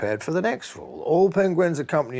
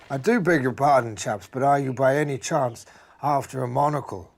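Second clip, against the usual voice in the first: a hint of a raised, courteous gentleman badger; lightly voiced polite badger; 5 semitones higher, 4 dB louder than usual. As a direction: voice Jeff the badger with a touch of old-fashioned politeness, a little higher. Posh badger Jeff speaks.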